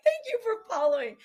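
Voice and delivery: high, loud thanks through laughter; laughing thanks. Real, not acted. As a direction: thank them while cracking up. Thanks followers while laughing.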